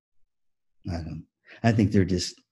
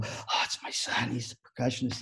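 shaken dad; 8 dB quieter than usual. Retold or imitated. Band dad telling the helper about his son, shaken. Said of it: "he was just shaking".

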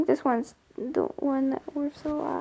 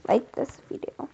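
usual speaking voice

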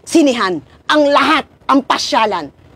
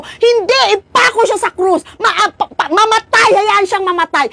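high, raised mob cry acted out; crowd shouting; 10 semitones higher than usual. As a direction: play the angry crowd demanding death. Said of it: "nung sigaw ng tao". (ginagayang sigaw ng galit na madla)

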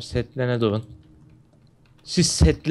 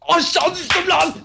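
much higher, slow dismayed yelp; shocked outcry; 14 semitones higher, 3 dB louder, slower than usual. Real, not acted. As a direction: cry out as you fall off the map. Player falls in game, cries out.

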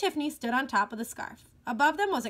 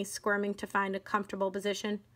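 usual speaking voice

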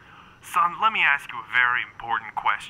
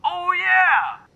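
high, loud eager challenge; pumped up for a brawl; excited shout; 5 dB louder, much slower than usual. Acted. Eager for the fight.

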